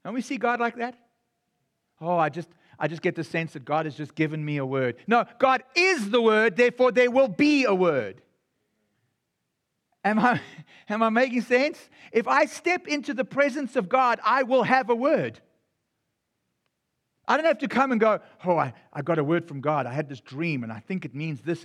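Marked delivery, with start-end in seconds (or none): 2.0-5.0 soft, lower, fast mock piety; quick mocking lower-voiced impression; mocking pious voice. Mocking believers' pious talk. 18.4-21.6 lower, soft, fast mock piety; hushed mocking churchgoer voice; mocking pious voice. Mocking believers' pious talk.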